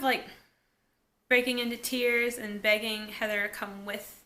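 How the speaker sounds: ordinary talk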